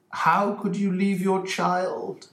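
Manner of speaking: high, slow searching question; Holmes asking. Acted. Holmes gently questioning the mother, high and slow. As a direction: ask it slowly, probing.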